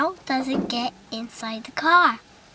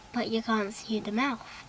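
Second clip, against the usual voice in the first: ordinary delivery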